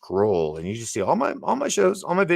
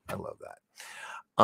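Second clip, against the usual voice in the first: whisper; 5 dB quieter, much slower than usual. Real, not acted. Whispered affection.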